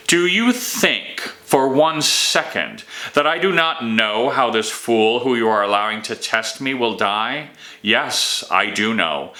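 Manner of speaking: usual speaking voice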